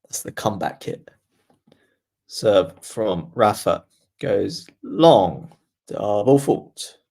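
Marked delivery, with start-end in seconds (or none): 2.3-7.0 slow, louder, drawn-out call; slow commentary call. Acted. Streamer calling the double fault, slow commentator voice. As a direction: stretch the call out like a broadcaster. Play-by-play of the serve.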